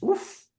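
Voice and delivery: a high, sharp oof; impressed oof. Real, not acted. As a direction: react like it hit hard. Reacting to powerful quote.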